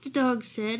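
plain reading voice

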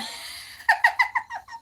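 a sudden high peal of real laughter; high burst of laughter. Real, not acted. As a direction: let the laugh ring out high and free. Laughs at a viewer comment.

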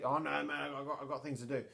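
put-on lightly done Australian accent, casual brush-off; a hint of an Australian driver waving them off; Australian taxi driver. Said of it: "why the taxi driver is Australian".

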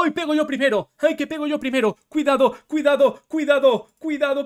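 loud, high panicky calls; excited shout. Real, not acted. Player warning viewers mid-fight, loud panic. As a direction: yell the warning urgently.

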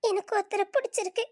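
ordinary delivery

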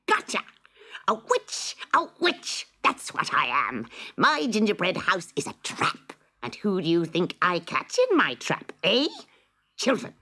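high, triumphant witch crowing over her catch; gleeful shrieking witch. Acted. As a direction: shriek with delight, the trap has sprung. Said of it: "shrieked with delight".

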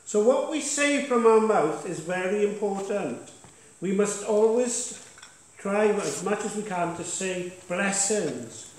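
punchy pulpit declaration; preaching. Acted. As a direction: exhort the church, pushing key words. Pastor exhorts congregation from the front.

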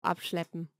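ordinary talk, nothing marked

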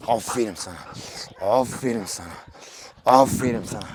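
low, soft praise for a pet. Real, not acted. Praising his pigeon, soft and low. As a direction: coo the praise quietly to the bird.

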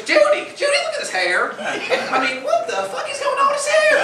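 high, calling coworker over; high mock gossiping hairdresser; shrill gossiping stylist. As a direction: squeal to a coworker in mock shock. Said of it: "she goes".

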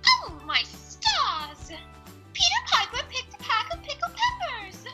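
high, slow posh lady voice; hammed-up high society lady; Rarity voice. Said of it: "that was terrible".

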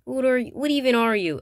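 high, loud demanding question; loud challenge. Acted. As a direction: demand to know what he is. Challenging the other figure.

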